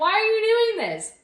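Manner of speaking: high, loud mock-anguished plea; exasperated outcry. Audience member recalling her reaction to miserable dancers, dismayed outcry. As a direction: wail it in hammed-up disbelief.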